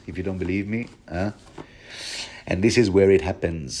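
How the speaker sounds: ordinary delivery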